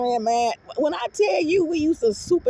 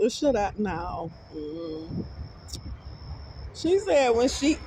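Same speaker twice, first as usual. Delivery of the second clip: ordinary talk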